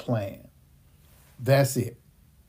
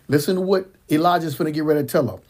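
usual speaking voice

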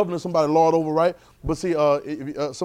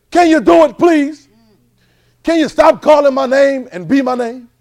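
higher and loud, an exasperated Jesus pleading back; 8 semitones higher, 11 dB louder, slower than usual. Retold or imitated. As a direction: voice Jesus fed up with being called. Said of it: "And Jesus keeps saying".

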